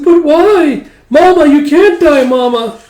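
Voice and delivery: put-on high loud child voice begging; a small child refusing to let go; pleading little girl. Yoko begging Mama not to die, high pleading child.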